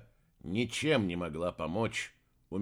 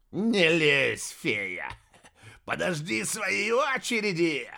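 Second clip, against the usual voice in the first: loud opening, rising into a menacing cry; angry threat; 6 semitones higher, 4 dB louder, slower than usual. A narrator's reading. Heir threatening the fallen fairy, angry. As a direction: warn her off nastily. Villain snarls at girl he struck.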